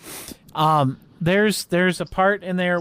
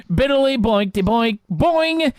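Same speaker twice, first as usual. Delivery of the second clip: mock angry; 7 semitones higher than usual. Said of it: "Mike very angrily goes".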